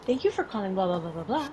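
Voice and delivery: polite receptionist voice, lifted at the greeting; phone voice. Shown as a demonstration. Demonstrating her work phone greeting to cohost, put-on phone voice. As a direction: answer like customer service, tailing off into rote blahs. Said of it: "a phone voice where you're like".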